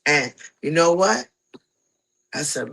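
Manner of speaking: ordinary talk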